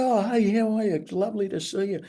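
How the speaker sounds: high, startled but friendly greeting, retold; a mock-surprised oh, hey; surprised hello. Matt caught off guard by the young woman, high surprised hello.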